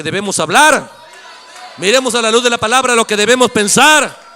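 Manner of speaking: shouted, emphatic preacher's refrain; full-force preaching. Real, not acted. Preacher exhorting the congregation, shouting with force. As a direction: drive each phrase home to the congregation, loudly.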